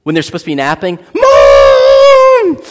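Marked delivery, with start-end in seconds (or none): 1.1-2.6 high loud screamed Mom; shrieking toddler call, held long; child screaming. Retold or imitated. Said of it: "starts screaming for you".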